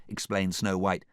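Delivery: ordinary delivery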